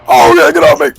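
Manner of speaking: very high, loud shriek to get off; panicked yell. Real, not acted. Player grabbed by a zombie, high panicked yelling. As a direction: yell it like it's on you right now.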